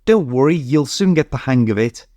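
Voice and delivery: ordinary delivery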